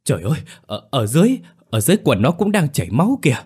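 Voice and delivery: high, loud, fast shriek of horror; terrified scream. A narrator's reading. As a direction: blurt it out in panic. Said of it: "bất ngờ kêu thét".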